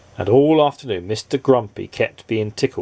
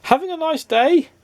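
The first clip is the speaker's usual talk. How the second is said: much higher, bright hello; cheery greeting. A narrator's reading. Happy neighbour greeting the grump, cheery and high. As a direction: greet him with a big grin. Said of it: "grinned Mister Happy".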